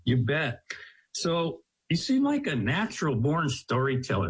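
normal talking voice

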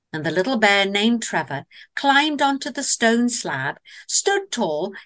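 plain reading voice

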